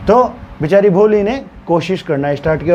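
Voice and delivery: plain reading voice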